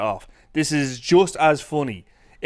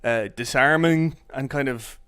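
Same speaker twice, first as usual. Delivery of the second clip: normal talking voice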